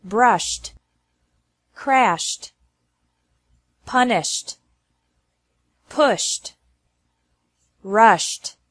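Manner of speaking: Brushed, crashed, rushed ending in SH plus T; punished closing on a T, no extra syllable. Shown as a demonstration. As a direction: say pushed with ED as a T straight after SH. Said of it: "E D is pronounced like T".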